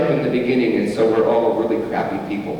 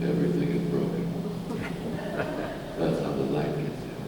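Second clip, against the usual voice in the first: much lower and softer, a deep murmuring croon; 12 semitones lower, 10 dB quieter, faster than usual. Retold or imitated. Pastor imitates the songwriter quoting his own lyric, low and soft. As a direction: drop way down and murmur it like the old singer. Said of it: "he said it kinda like".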